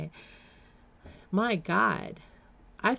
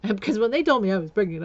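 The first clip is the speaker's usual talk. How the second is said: ordinary talk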